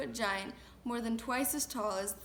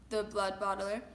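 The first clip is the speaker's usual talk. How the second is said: ordinary delivery, nothing marked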